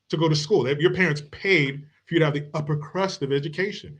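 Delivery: ordinary talk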